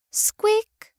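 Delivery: high, tiny squeak like a rat. Acted. Pet rat squeaking back at its owner, high squeak. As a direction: squeak out a rodent's reply.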